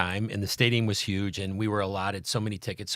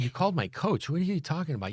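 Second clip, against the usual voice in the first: retold disbelief; 7 semitones higher, 4 dB quieter than usual. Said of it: "I said".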